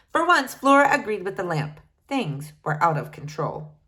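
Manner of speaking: usual speaking voice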